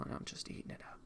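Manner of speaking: whispered aside. Real, not acted. Streamer confiding to chat about the show, whispered. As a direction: let it slip out in a secret whisper.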